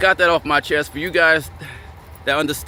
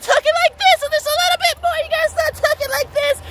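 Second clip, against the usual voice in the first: very high, squeaky put-on voice; mock soft-talker. Man afraid to offend her, mocking high voice. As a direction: mimic a man with no bass left in his voice. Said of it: "gotta start talking softer".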